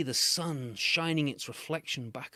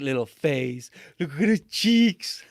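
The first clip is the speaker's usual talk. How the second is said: gushing coo; 2 semitones higher, 8 dB louder, slower than usual. Real, not acted. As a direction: squeal with adoration. Cooing over child's picture.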